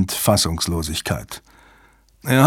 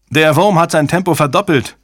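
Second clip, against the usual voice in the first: higher, louder urgent shout; 6 semitones higher, 4 dB louder than usual. A narrator's reading. Soshi reports the worm speeding up, shouting. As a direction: cry out the bad news. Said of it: "schrie Soshi".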